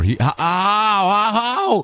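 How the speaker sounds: high, drawn-out cries of pain; stretched, pained "ow, ow" at the loss; anguished ow. Real, not acted. Zombie kills his villager.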